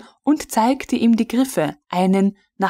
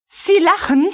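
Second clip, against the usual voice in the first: higher, louder outraged stammer; furious stammer; 10 semitones higher, 4 dB louder than usual. A narrator's reading. Frau von Melin sputtering at the laughing officer, furious. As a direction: sputter in disbelief and rage. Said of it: "stammelte der weibliche Oberst wütend".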